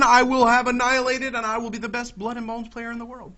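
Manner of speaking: normal talking voice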